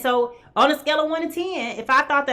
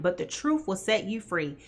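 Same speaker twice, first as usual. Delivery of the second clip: usual speaking voice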